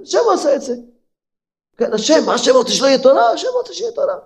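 lightly voiced high puzzled man; a hint of a mocking skeptic; mock confused listener. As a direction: act out the doubter's baffled question. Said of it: "הוא שומע ככה".

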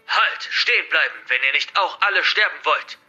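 loud, high shout to halt; shouted order, high and forceful; commanding scream. Acted. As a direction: scream a threat to freeze them. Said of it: "doch ein schreiendes".

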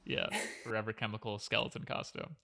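ordinary delivery

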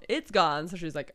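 ordinary delivery

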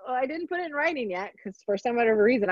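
ordinary talk

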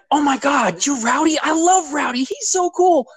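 high-pitched mock gushing; excited fan imitation. Retelling a thrilled fan's reaction.

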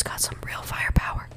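breathy whispered line. Shown as a demonstration. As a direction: whisper it close to the ear. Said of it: "if I whisper you the line".

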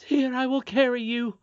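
high-pitched, urgent nurse voice; flustered nurse. A narrator's reading. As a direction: offer hurriedly as a worried nurse. Frantic nurse offers to carry princess.

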